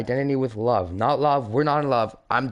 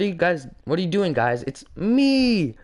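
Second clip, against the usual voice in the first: panicked protest; 7 semitones higher than usual. Acted. Warden protests as he is tased.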